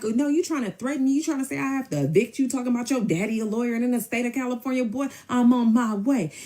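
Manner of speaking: high, indignant retelling; heated comeback. Retold or imitated. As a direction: fire back at him, pitched high. Acted quarrel, her angry comeback.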